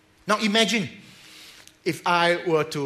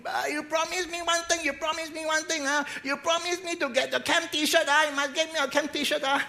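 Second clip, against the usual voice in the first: put-on much higher, whiny childlike pleading; pleading like a child; 12 semitones higher than usual. Patient pleads with the pastor for the camp T-shirt. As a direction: nag like a child holding you to a promise. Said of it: "Then he said".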